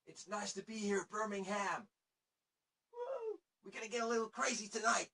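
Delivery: high, loud, manic pop-punk singer hyping the crowd; crazy frontman impression. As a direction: imitate a wild frontman whipping up the audience. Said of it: "That is how he sounded".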